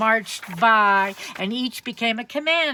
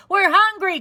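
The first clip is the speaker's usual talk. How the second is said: high, loud hungry cry; soldiers crying out; 12 semitones higher, 7 dB louder than usual. Acted. Hungry soldiers calling out, loud. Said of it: "cried all the soldiers".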